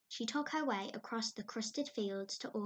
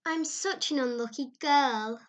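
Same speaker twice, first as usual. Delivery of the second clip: mournful; 4 semitones higher, 9 dB louder than usual. Acted. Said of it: "mourned Anne".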